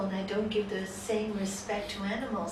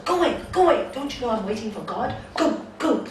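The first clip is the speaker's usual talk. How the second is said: irritated shooing; 2 semitones higher, 7 dB louder, faster than usual. Acted. Man sending a dog from his door, irritated. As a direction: shoo the dog off sharply.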